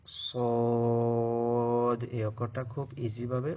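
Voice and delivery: সদ held long, full and heavy; drawn-out sad. Shown as a demonstration. Teacher showing students the heavy letter সদ. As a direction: stretch সদ with a thick s sound. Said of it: "একটু মোটা হবে".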